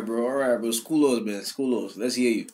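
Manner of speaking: ordinary delivery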